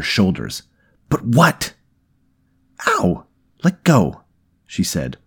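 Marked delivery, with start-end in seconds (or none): 1.1-1.7 loud, high, urgent demand; panicked demand. Acted. Man grabbing her shoulders mid panic attack, urgent. 2.9-4.2 high, loud yelp of pain; pained protest. Acted. Woman to man gripping her shoulders, pained yelp. Wincing at his hard grip.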